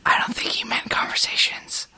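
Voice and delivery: whispered aside; whispered side comment. Real, not acted. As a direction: murmur it so only they hear. Aside to co-hosts.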